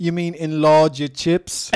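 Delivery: put-on loud, slow, high British accent; slow, exaggerated British questioning; Jason Statham. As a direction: belt out an incredulous British accent. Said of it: "had to do the accent".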